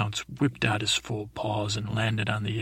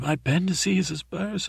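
high-pitched, put-on wail of pain; mock wailing; 7 semitones higher than usual. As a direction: wail it higher, as if faking agony. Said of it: "I started wailing and wailing".

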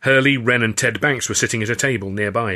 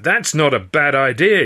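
raised, slower call; higher, louder interjection; 5 semitones higher, 6 dB louder than usual. A narrator's reading. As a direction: call it out as the idea lands. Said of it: "Makepeace called out".

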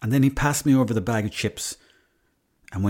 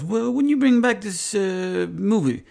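put-on high-pitched querying Italian accent; 10 semitones higher, slower than usual. Italian fella chasing a rented movie, Italian accent. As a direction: ask in a much higher Italian accent. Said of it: "the Italian fella".

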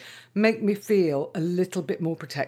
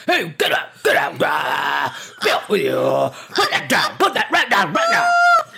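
posh army major shout; 4 dB louder than usual. Retold or imitated. Mother shooing a fox like her army major dad. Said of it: "strict army major mode like my dad and start shouting in a very posh voice".

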